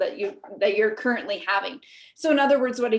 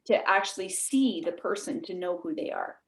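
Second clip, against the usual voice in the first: usual speaking voice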